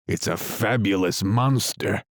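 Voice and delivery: ordinary delivery, nothing marked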